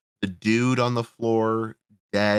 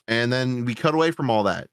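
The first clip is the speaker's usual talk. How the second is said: ordinary talk, nothing marked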